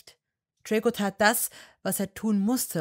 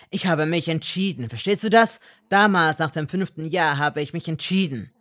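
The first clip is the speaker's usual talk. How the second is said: very loud angry outburst; furious shout; 10 dB louder than usual. A narrator's reading. Malfoy yelling at Hermine. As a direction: yell it at her.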